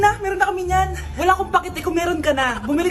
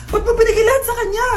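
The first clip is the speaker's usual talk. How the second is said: high, louder wailing complaint; mock crying; 6 semitones higher, 4 dB louder than usual. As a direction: wail it like a hammed-up sob. Mother realizes everything given away. (kunwaring umiiyak)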